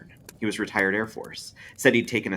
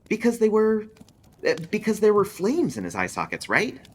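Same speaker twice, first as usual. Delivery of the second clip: Marcy's eager answer; 14 semitones higher, 4 dB louder than usual. A narrator's reading. Marcy voiced in read story.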